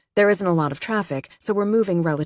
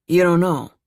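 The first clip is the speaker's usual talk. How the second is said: a louder, flat falling "You don't know." said as a statement with no question lift; 6 dB louder than usual. Acted. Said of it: "He doesn't say it like a question".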